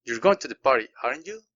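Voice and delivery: tag question rising, stress on "you" at the end of "aren't you?"; rising intonation. Shown as a demonstration. Teacher demos a real question, rising intonation. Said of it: "empieza abajo y al final termino con el volumen arriba".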